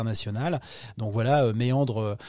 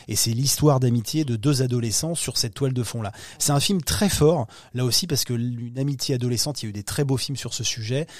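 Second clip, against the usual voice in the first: usual speaking voice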